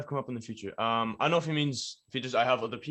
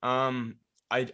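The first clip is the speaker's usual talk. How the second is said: normal talking voice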